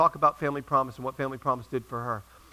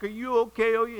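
ordinary delivery

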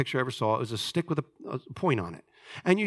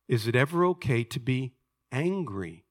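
ordinary talk

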